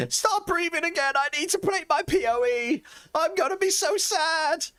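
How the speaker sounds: very high, hammed-up sobbing plea; mock wailing. Streamer imitating Clink doing CPR on an Xbox, mock wailing. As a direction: wail in falsetto, imitating a distraught gamer. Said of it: "Me making funny noises".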